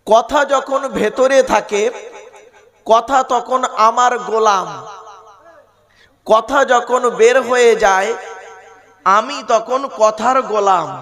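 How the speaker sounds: slow and deliberate, each phrase weighed; slow, weighty delivery. Shown as a demonstration. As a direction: lay down a maxim slowly. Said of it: "আবার বলছি".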